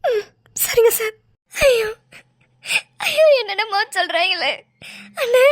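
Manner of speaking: crying, voice breaking on ஐயோ. Acted. Wife crying after the doctor's warning, sobbing. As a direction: sob through the words.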